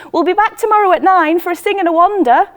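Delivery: loud, high and fast call upward. A narrator's reading. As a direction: shout the plan up cheerfully. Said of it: "says Betty".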